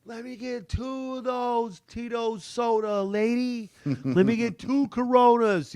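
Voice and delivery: high, slow, giddy order at the bar; high, drawn-out request to the waitress; drawn-out drink order. Retold or imitated. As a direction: stretch out the drink order like a happy winner. Acting out ordering drinks while winning.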